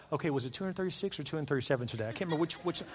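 fast, soft, low muttering; muttered counting. Acted. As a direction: mumble as if losing track. Imagines losing count of forgiveness.

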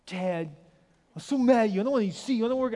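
retold teenage outburst; upset teen. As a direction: play the sulky son pushing dad away. Son refusing to see dad.

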